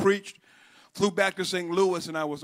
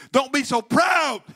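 slow, raised preacher's cry; forceful exhortation; 7 semitones higher, 6 dB louder, slower than usual. Real, not acted. Preacher warning those who hide their struggle, forceful exhortation. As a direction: cry it out to the congregation.

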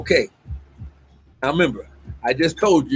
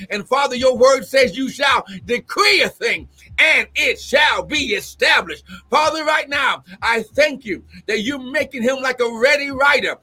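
fervent, raised preacher's prayer; prophetic declaration prayer; 7 semitones higher than usual. Acted. Prophet praying to Father over the apostle, fervent declaration. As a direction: decree it forcefully to the Father.

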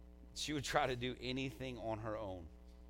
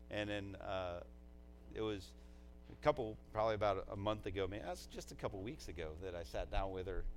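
normal talking voice

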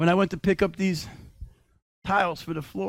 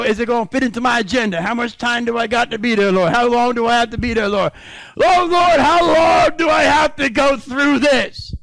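fast, whiny questions building to a loud, higher wail on Lord, Lord; mock whiner; 5 semitones higher, 6 dB louder than usual. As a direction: whine faster, then cry out higher and louder. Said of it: "A lot of us wanna know".

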